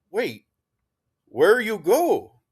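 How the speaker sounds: high, loud, drawn-out plea; urgent question. Acted. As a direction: stop him before he leaves. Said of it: "Narvaez asked quickly".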